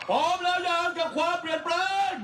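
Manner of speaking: rally speech. Real, not acted. Speaker rousing rally crowd.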